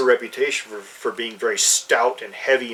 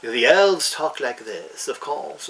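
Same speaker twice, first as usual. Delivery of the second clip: mock posh elf; slower than usual. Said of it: "It's like".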